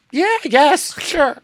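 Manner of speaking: high, hesitant mock shrug of agreement; reluctant yes. To the young woman, a high reluctant yes.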